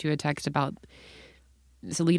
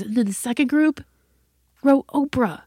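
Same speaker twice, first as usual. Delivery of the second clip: high, loud tattling daughter; incredulous kid's report; 8 semitones higher, 6 dB louder than usual. Retold or imitated. Daughter reporting class blunder to mom, high incredulous voice. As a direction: build to a scandalized high punchline.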